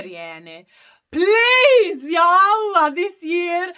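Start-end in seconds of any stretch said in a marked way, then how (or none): 1.1-3.7 heartfelt plea, high and loud; pleading. Real, not acted. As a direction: plead fervently, drawing out the opening words. Praying aloud to visit Ireland.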